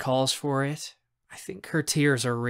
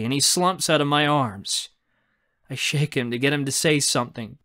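ordinary delivery, nothing marked

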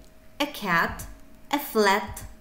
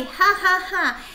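high, loud demo laugh to show funny; mock laugh; 9 semitones higher, 5 dB louder than usual. Shown as a demonstration. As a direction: act out a laugh for the word funny. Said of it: "usually funny".

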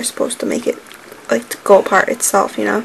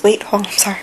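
ordinary talk

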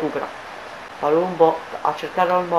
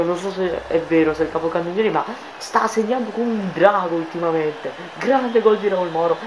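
normal talking voice

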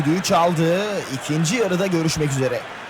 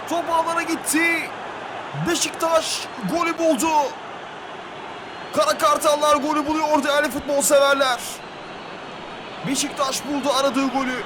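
excited commentator; 6 semitones higher than usual. Real, not acted. Just after the goal.

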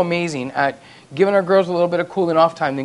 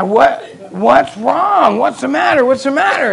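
much higher, very slow hammed-up concern; mock alarmed asking; 12 semitones higher, 5 dB louder, slower than usual. Husband anxiously asking his furious wife what happened, hammed-up. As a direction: draw it out, wide-eyed and worried.

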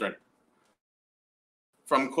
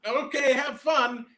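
cheery send-off; 8 semitones higher, 3 dB louder, slower than usual. Retold or imitated. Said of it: "And then I said".

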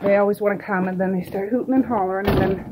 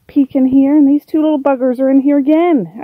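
exasperated surprise; 6 semitones higher, 10 dB louder than usual. Real, not acted. Finds raccoons in the garbage.

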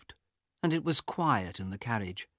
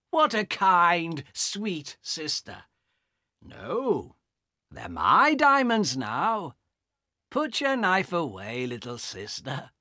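slow, sarcastic sneer; mocking brother; 6 semitones higher, 4 dB louder, slower than usual. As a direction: sweet-talk her with contempt. Carl mocks the knife threat.

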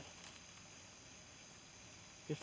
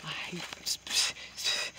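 stammering whisper; 4 dB louder, slower than usual. Real, not acted. Sees a spider while hunting.

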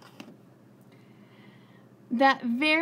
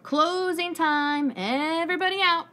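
calling out; 6 semitones higher, 3 dB louder than usual. A narrator's reading. Manager calling closing time to the customers, loud call. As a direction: call it out to the whole laundromat. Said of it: "the manager of the laundromat called".

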